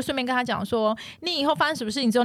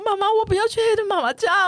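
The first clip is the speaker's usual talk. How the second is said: put-on higher, louder little-girl plea; little girl pleading; 9 semitones higher, 4 dB louder than usual. Mother imitating her daughter's higher, louder pleading. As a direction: beg like a scared little daughter. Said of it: "我女儿就说".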